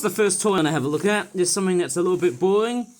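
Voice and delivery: ordinary talk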